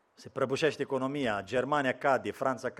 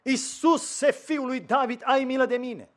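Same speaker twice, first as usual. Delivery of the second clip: crying out; 11 semitones higher, 5 dB louder, slower than usual. Retold or imitated. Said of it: "a început să strige cât a putut de tare".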